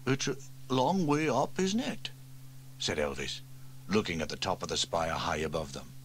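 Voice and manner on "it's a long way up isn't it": lightly voiced, doubtful; hesitant fireman. Elvis eyes the tall spire, doubtfully. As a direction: hedge nervously.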